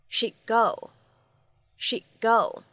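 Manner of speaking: "She'd go" with the d unreleased into the g; lost plosion demo. Shown as a demonstration. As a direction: glide "She'd go" together, d held silent. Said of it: "失去爆破的处理".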